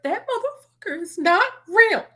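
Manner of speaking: deadpan joke; mock outrage. To live viewers, claiming the man is fake, then joking: mock outraged. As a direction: pitch jumps up for a fake dramatic claim. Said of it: "No, I'm joking".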